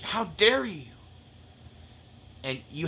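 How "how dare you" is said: sharp, higher rebuke; indignant challenge. Pretend. Preacher voicing righteous indignation at the devil. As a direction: throw it at the enemy like an accusation. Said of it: "that righteous indignation".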